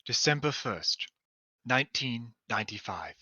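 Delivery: soft, hushed poetic reading; poem reading. Acted. Reading the dream journal to friends, hushed poetic reading. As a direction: read it like a quiet dream.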